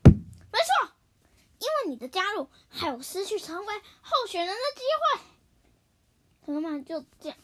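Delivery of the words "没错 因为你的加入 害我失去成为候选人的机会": loud, high angry outburst. Acted. Apan angrily accusing the hippo of stealing his spot. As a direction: snap back in a huff. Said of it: "阿潘就生气了".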